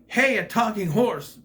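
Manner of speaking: a hint of a startled Geppetto; mock surprise. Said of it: "Geppetto should have been like".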